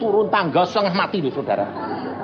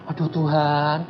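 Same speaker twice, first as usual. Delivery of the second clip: sleepy; 3 semitones lower, 6 dB quieter, slower than usual. Acted. Acting being woken to pray.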